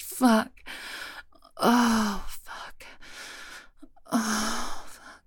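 loud breathy moans building then fading; climax moaning. Acted. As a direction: moan through climax, panting.

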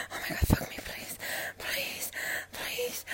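breathy pleading whisper. Real, not acted. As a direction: beg in a hushed whisper.

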